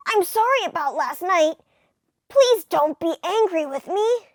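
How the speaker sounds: a hint of an apologetic nerd; nerdy voice. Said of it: "Clark's gonna have a nerdy voice".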